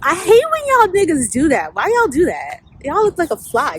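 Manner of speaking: loud and exasperated; exasperated rant. Real, not acted. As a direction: call them out, fed up. Ranting at men who do that.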